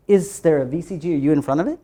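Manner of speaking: ordinary delivery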